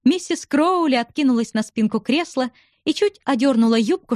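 ordinary delivery, nothing marked

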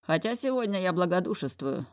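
ordinary delivery, nothing marked

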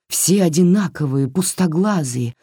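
ordinary delivery

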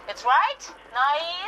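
high, loud English call; tipsy call out. Acted. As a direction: call across the room, giddy. Beschwipst ruft Gastgeberin zu. (beschwipster, hoher Zuruf)

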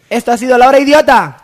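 hammed-up host farewell; show sign-off.

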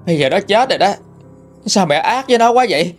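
anguished accusation. A narrator's reading. Grieving mother confronts mother-in-law.

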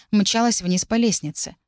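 ordinary delivery, nothing marked

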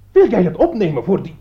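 loud, high accusation; accusing. Acted. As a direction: throw the charge at him. Accusing quarrel. (beschuldigend en luid)

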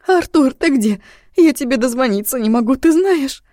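high, tearful sobbing voice on the phone; crying on the phone. Acted. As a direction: sob the words out loud and high. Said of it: "всхлипывала она в трубку".

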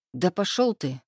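mildly annoyed brush-off; a softened, grudging dismissal. A narrator's reading. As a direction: tell him off without real venom. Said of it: "уже не так злобно ответил Игорёк".

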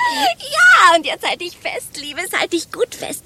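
high, excited gossip, starting loud then stretched out; thrilled build-up. Acted. Woman bracing her friend for a bombshell, thrilled and high. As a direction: tease the bombshell at high pitch.

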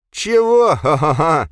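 loud, high-pitched incredulous guffaw; mocking laugh. A narrator's reading. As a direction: squeal the question, then laugh loudly. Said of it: "рассмеялся мажор".

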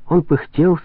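ordinary delivery

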